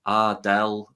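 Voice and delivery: light imitation of a Liverpudlian accent; a quick hint of Liverpudlian slang voice. Quoting a game brother to friends, mild Liverpudlian accent. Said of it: "brothers who have Liverpudlian accent".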